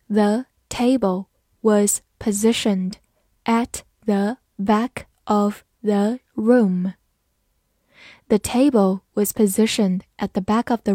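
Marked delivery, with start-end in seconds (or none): 0.1-6.9 slow, careful read-aloud split in two phrases. Shown as a demonstration. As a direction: read it slowly for students to follow. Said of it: "慢慢来读".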